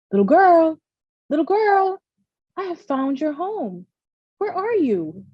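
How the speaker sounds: put-on a bird calling for the lost girl. A narrator's reading. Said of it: "He called for her".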